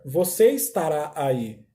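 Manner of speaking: ordinary delivery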